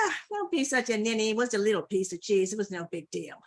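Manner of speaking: quick, offhand brush-off; dismissive cat. Acted. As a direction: shrug it off as nothing, tailing down. Cat brushes off Dog's upset.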